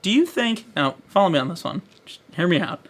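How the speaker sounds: usual speaking voice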